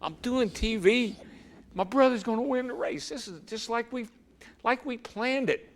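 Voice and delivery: can barely contain the joy as his brother leads; real excitement. Real, not acted. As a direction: voice jumps high and slows, thrilled. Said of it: "And man, I'm getting excited".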